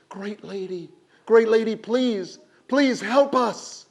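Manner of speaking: high, loud begging, slowing on please; desperate plea. A narrator's reading. Villagers beg Silver Hands for help, high desperate plea. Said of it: "In their desperation".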